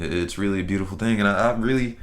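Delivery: ordinary talk, nothing marked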